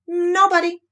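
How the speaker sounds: high, put-on voice of nobody; disguised voice. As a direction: fake a stranger's voice. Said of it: "in a different sort of voice".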